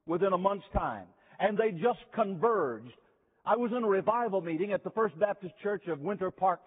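ordinary delivery

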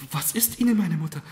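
high, loud, startled concern; dismayed question. A narrator's reading. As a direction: ask your mother in alarm. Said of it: "Die Marquise fragte bestürzt".

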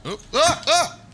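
sudden high, loud yelps of alarm; real panicked outburst; startled yelling. Real, not acted. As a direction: cry out as if caught by a jump scare. Startled by sudden event in game.

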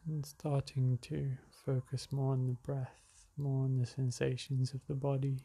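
calm, unhurried meditation guide; spacious, settled relaxation-exercise delivery; guided meditation. Acted. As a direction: ease them into slowing the breath, with long pauses.

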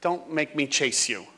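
coax, then give up, exhausted; defeated teacher. Retold or imitated. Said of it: "the poor teacher's like".

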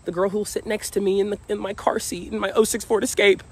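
a hint of a naive dreamer; mock old self. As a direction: tease your younger daydreaming self. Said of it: "I used to say".

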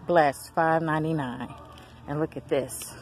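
ordinary talk, nothing marked

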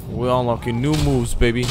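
ordinary talk, nothing marked

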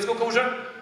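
normal talking voice

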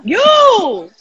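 sharp high accusing shout; loud. Real, not acted. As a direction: fire it back loud and high. Accusing friend in banter.

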